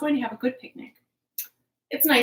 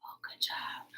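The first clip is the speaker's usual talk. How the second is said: whispered praise, much softer; 13 dB quieter than usual. Real, not acted. Teacher whispering praise to the children after the song. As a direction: hush it out gently.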